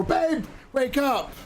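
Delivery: high, slow panicked plea to wake her; mock-frantic boyfriend pleading. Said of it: "Mister Tofu's in the back seat".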